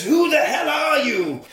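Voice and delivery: high, laughing "who the hell"; a mock shocked hero, half laughing; shocked demand. Retelling the game, the hero demands the goblin's name, laughing. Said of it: "and he says".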